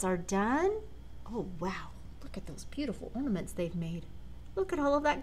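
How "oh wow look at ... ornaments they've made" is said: usual speaking voice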